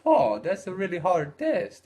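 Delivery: mildly voiced Italian guy complaining. Italian guy grumbling about the hard test, lightly put-on voice. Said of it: "So the Italian guy says".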